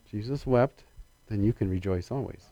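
dropped to a near hush; softly voiced reflection. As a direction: let it fall soft and low. Preacher softly restating verses.

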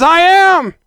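high, loud ringing cry; triumphant proclamation. Acted. Preacher finishing before Abraham was, triumphant cry. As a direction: let the two words ring out.

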